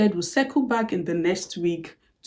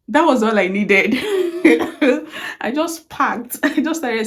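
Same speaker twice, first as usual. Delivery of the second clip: laughing; 4 semitones higher, 5 dB louder than usual. Real, not acted. Laughing at the memory.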